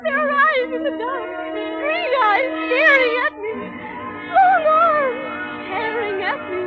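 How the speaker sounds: high, loud, building panic to screams for help; lost and terrified, panic rising to a scream; terrified cries. Acted. Princess lost, terrified in forest.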